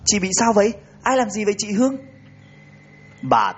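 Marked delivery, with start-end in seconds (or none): 0.1-1.9 frantic questions into the phone; giọng hốt hoảng; panicked. Acted. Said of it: "giọng hốt hoảng".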